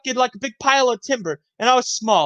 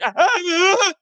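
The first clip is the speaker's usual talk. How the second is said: mock high whimper; 9 semitones higher than usual. Acts out hurt boy whimpering.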